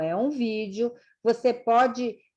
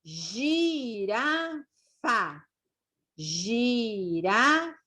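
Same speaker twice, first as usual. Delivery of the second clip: very slow, stretched syllables; syllable-by-syllable reading; slower than usual. Shown as a demonstration. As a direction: draw out each syllable for a beginner to follow. Teacher sounding out example words.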